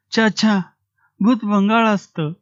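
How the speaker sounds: put-on high boy's voice, dismissive; a lightly voiced child refusing; boy answering. A narrator's reading. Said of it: "तुक्या उत्तरला".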